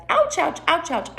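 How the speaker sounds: high quick yelps, coming down; mock little cries of pain; jury's mock pain. Jury reacting to the queen, high pretend ouches.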